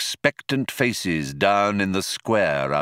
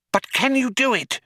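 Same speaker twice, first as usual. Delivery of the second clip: fast, high urgent cry; faster than usual. A narrator's reading. Loonquall presses the computer for help, urgent cry. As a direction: cry it out impatiently. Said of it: "cried Loonquall".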